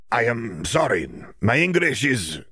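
put-on slow, heavy Eastern European accent; Bulgarian accent. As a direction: speak slowly in Krum's Bulgarian accent. Krum apologizes for English.

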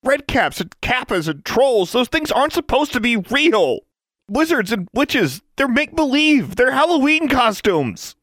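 high, loud, slow emphatic rant; shouting. A narrator's reading. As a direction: shout down each fantasy in turn. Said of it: "he shouted".

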